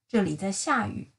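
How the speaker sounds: usual speaking voice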